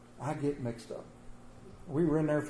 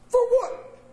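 much higher, laughing, totally lost; baffled squeak; 5 dB louder than usual. Retold or imitated. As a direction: push the confusion way up into a squeak. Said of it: "And I went".

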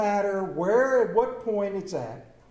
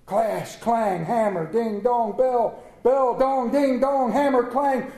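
rising, louder, ringing out each word; chanted bell sounds; 3 semitones higher, 3 dB louder, slower than usual. Acted. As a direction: chime like church bells. Imitating ringing church bells.

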